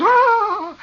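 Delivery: high, loud mock scream; shocked shriek. Neighbor discovering the old man's disgrace, acted shriek. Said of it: "And she goes".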